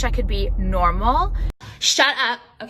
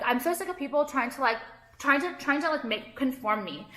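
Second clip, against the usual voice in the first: ordinary talk, nothing marked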